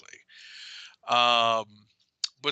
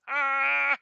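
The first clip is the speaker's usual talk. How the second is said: triumphant cry; 12 semitones higher, 5 dB louder than usual. Retold or imitated.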